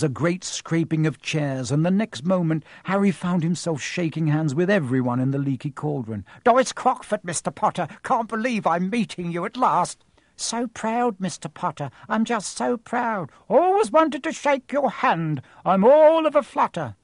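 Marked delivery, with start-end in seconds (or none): 6.4-17.0 high, breathless fluttery gush; thrilled to bits to meet him; gushing old lady. Acted. Doris Crockford gushing over Harry, high and fluttery.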